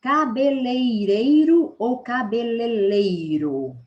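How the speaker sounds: cabeleireiro with -reiro, then cabeleleiro with -leiro, slow and loud; right vs wrong form. Shown as a demonstration. Teacher asking which form is right, slow and loud. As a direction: draw out both forms loudly and slowly. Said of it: "Muita gente fala cabeleireiro".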